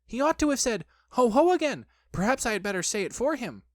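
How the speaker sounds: high-pitched fretting; squeaky nervous thinking; anxious Piglet. A narrator's reading. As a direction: worry it through in a high little voice.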